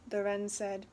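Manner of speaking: plain reading voice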